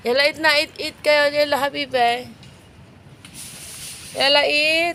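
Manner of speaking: loud, high call to the cats. Real, not acted. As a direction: call them over to eat. Calling cats to eat. (malakas na tawag sa mga pusa)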